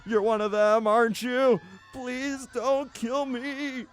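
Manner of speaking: panicked pleading, rising high; panicked surrender shout. Acted. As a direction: beg for your life in panic. Host begs for his life.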